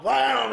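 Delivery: high, loud "wham"; imitated impact. Telling how another chair hit the wall, imitated impact. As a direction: punch it out like a crash effect.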